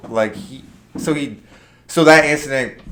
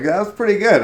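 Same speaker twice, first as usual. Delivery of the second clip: much higher, amused; impressed praise; 10 semitones higher, 4 dB louder than usual. Retold or imitated. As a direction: grudgingly admire the prank. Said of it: "And I was like".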